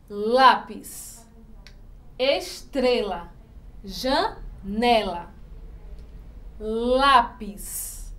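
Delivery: "lápis, estrela, janela" slowly, LÁ, TRE, NE drawn out; stress on penultimate syllable. Shown as a demonstration. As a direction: lean long on LÁ, TRE and NE. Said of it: "essa sílaba forte". ("lápis, estrela, janela" devagar, com força na penúltima sílaba)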